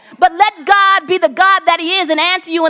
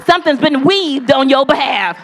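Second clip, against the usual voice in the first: ordinary delivery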